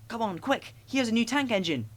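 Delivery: put-on excited boys. A narrator's reading.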